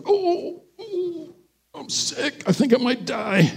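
very high, breathy moaning of a sick man; mock dying whine. Pastor mimicking a sick groan at bedtime, high mock whimper. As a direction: ham up feeling deathly ill at bedtime. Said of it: "it's like".

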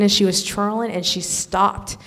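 ordinary talk, nothing marked

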